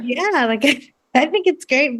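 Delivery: ordinary delivery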